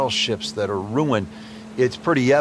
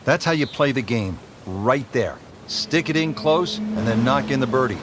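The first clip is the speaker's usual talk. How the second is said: golf commentator. Acted.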